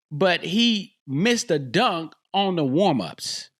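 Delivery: ordinary delivery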